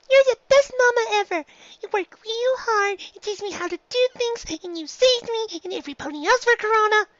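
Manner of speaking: high filly voice, eager; a filly praising her mama; young filly Dinky, eager. A narrator's reading. Filly praising her mother, high and eager.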